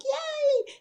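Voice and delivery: very high mock cheer; imitated cheer. Retelling people cheering from behind the doors, imitated excited cheer. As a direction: squeal a yay, imitating an excited crowd.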